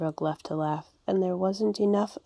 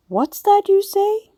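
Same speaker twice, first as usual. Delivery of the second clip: much higher, louder and slow, can't believe his ears; disbelieving cry; 9 dB louder than usual. A narrator's reading. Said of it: "cried Grandfather Frog".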